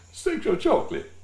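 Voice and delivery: high, loud hammed-up band intro; mock announcer shout. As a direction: belt the name out like a showman. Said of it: "Randy Watson, oh man".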